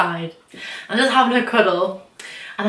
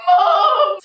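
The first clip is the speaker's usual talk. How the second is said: very high, drawn-out cry; mock wail for mum; 4 dB louder than usual. Calling mum for help, exaggerated high wail. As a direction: bawl it in comic despair.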